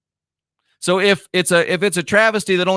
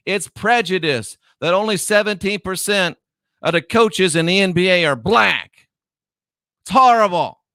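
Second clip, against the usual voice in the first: high-pitched sarcastic indignation; mock outrage; 5 semitones higher than usual. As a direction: act upset on their behalf, pitched up. Mocking NBA's outrage over coaches.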